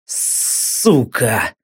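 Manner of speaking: low, soft hissed curse. Acted. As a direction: spit it out through teeth. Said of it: "прошипел я".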